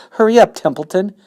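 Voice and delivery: high, loud, pressing plea; urgent plea. Acted. Pig pleads with the rat, high loud urging. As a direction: hurry him along, loud.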